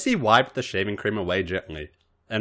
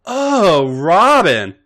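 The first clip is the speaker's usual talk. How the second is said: much higher and louder, dazzled cry; awed exclamation; 9 semitones higher, 11 dB louder, much slower than usual. Acted. Missy seeing Robin dressed up, awed high cry. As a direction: gasp out her name in delight.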